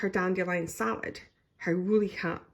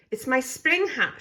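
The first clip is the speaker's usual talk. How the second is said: cried; 7 semitones higher, 4 dB louder than usual. A narrator's reading. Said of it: "she cried".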